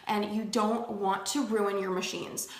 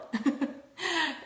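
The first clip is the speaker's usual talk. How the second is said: short high laugh; light self-amused laugh; 6 semitones higher than usual. Real, not acted. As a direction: laugh at the mock mantra. Laughs at own joke.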